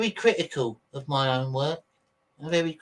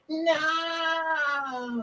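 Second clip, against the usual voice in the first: much higher, drawn-out overdramatic no; mock dramatic wail; 14 semitones higher, 4 dB quieter than usual. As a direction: ham up the drama queen. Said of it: "He was very screamy".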